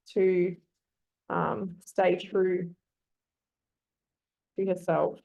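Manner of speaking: slow, halting, thinking each phrase out; building the sentence word by word for the class; slow composing aloud. Shown as a demonstration. Teacher to students, slowly composing a model sentence. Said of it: "So there's an example of".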